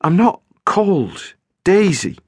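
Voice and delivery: said slowly. A narrator's reading. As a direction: spell out each word slowly. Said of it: "she said slowly".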